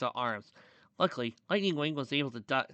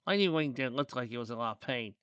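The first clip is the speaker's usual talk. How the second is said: usual speaking voice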